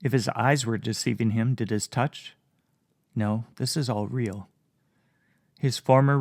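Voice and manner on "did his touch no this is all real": usual speaking voice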